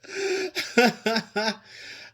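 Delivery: real laugh. Real, not acted. Laughing before defending Bentley.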